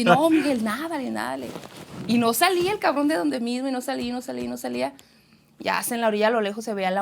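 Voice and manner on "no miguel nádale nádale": raised, pleading call to keep going; loud retold plea, easing off; urgent urging. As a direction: re-enact urging a tiring swimmer on. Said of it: "y ya yo así".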